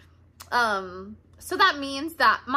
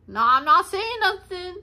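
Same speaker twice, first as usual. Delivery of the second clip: high, loud protest; insistent denial; 8 semitones higher, 9 dB louder than usual. Real, not acted. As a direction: protest innocence, voice jumping up. Insists she implies nothing.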